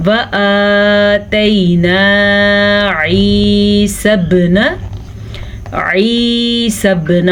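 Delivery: slow, drawn-out tajweed recitation; Quran recitation. Shown as a demonstration. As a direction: chant each word slowly. Recites the verse.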